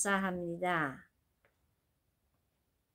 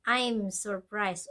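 slow model phrase; much slower than usual. Shown as a demonstration. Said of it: "어머".

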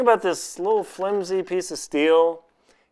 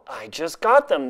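ordinary talk, nothing marked

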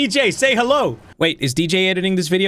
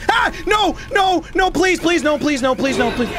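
panicked begging; 9 semitones higher than usual. Acted. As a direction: beg for your life as it closes in. Chased by animatronic, begging.

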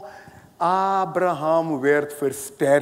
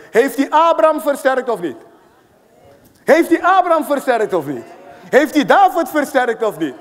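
rising, louder preacher's challenge; louder and higher repeated preacher's challenge; preaching at full force; 4 semitones higher, 4 dB louder than usual. Acted. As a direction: drive the question home, building each time. Preacher building rhetorical climax.

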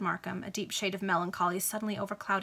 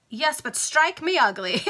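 slow, a touch higher and louder dandy drawl; lightly voiced preening dandy; foppish captain; 6 semitones higher, 6 dB louder than usual. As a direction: savour the silly oath.

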